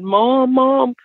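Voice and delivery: high, imitating a crying boy calling Mom; crying child. Son crying for mom after seeing something, high mock sob. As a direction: mimic a frightened son calling out for his mother. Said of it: "And he's crying".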